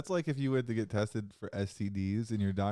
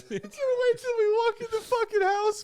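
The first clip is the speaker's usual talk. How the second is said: squeaky high laughing words, louder; crying laughing; 7 dB louder than usual. Real, not acted.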